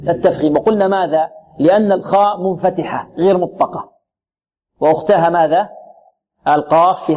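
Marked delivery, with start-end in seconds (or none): none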